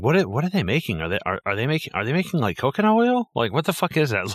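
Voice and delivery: puzzled questions, jumping high on coconut oil; retold bafflement. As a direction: replay your baffled first reaction. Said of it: "this totally surprised me".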